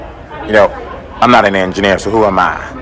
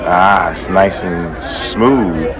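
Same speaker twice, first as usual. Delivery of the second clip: slow savoured drawl; 2 semitones lower, much slower than usual. Acted. Slow savoured tagline over music.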